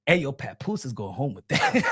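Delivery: ordinary talk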